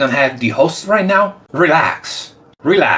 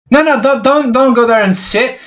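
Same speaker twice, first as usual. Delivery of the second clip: higher, louder no, no, don't; sudden loud protest; 9 semitones higher, 4 dB louder than usual. Real, not acted. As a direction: stop him before he sits. Stopping someone taking his seat.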